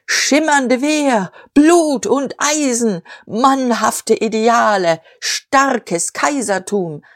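high, slow, drawn-out slogans; bombastic declaiming. A narrator's reading. Diederich amid the cheering loyalists, bombastic slogans. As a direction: hurl each power phrase like a rally orator. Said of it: "Seine Kraftworte stießen rasselnd aneinander".

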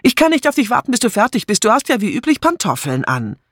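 high-pitched and impatient; hurried, impatient call. A narrator's reading. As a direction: brush her off in a rush. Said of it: "rief sie". (ungeduldig und eilig gerufen)

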